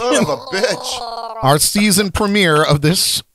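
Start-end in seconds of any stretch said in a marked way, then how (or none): 0.0-1.0 high cracking voice from the sour. Real, not acted. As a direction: voice breaking up. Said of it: "His voice is cracking".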